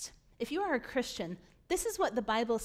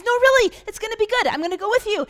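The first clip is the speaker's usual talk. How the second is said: much higher and louder, eager reassurance; excited God; 9 semitones higher, 9 dB louder, faster than usual. Retold or imitated. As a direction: cheer Moses on like a thrilled coach. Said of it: "God's all excited at this point".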